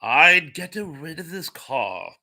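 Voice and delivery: slow, drawn-out impression of a British actor; Alec Guinness. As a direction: play the actor giving a slow warning. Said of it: "Sir Alec Guinness".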